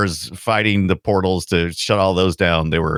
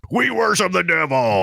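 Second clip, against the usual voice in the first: devil-worshipping metal guy; 10 semitones higher than usual. Retold or imitated. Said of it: "id Software's over here going".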